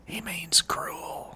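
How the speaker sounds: hushed whispered aside; whispering. Real, not acted. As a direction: whisper it as a side note. Whispered aside about Nate.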